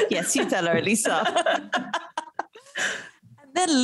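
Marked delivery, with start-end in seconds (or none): 1.2-2.4 soft laugh. Real, not acted. Still laughing at the joke.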